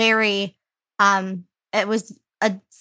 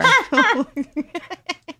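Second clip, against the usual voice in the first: sudden high laugh; 9 semitones higher, 5 dB louder than usual. Real, not acted. Laughing at friend's sixth grade British accent, high laugh. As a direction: burst out laughing.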